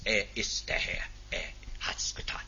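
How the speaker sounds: soft, hushed whisper of conviction; softly whispered confession of faith; awed whisper. Acted. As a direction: breathe it out in quiet awe.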